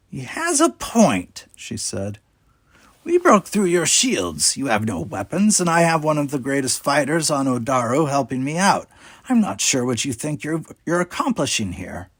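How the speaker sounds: a sly leader toying with her captives; cool pirate captain. A narrator's reading. Talis voiced in story.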